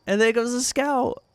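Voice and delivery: ordinary talk, nothing marked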